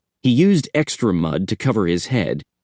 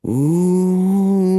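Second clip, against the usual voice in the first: spooky moan; 10 semitones higher than usual. Acted. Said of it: "he started to moan".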